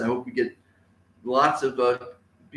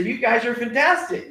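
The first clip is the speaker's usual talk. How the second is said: praising teacher; 7 semitones higher than usual. Shown as a demonstration. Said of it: "you as a teacher should say".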